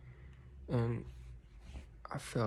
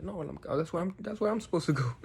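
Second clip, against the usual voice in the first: flustered, high and louder; retold confused fluster, raised pitch; 6 semitones higher, 4 dB louder than usual. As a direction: imitate someone realizing their mistake, stumbling over words.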